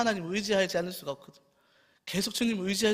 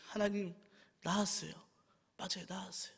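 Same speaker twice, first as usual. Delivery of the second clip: soft, quiet confession to God; whispered-soft I'm healed; hushed prayer; 12 dB quieter than usual. Acted. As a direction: murmur it like a private vow. Confessing healing before God.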